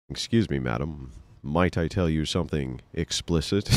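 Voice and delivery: the right way: hushed and overly refined; polite gentleman. Shown as a demonstration. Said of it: "You have to say".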